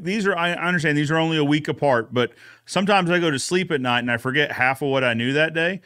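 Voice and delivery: usual speaking voice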